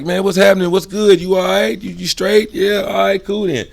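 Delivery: high, loud upbeat greeting; bouncy. Shown as a demonstration. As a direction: lift the pitch and bounce through it. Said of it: "I'm bouncy".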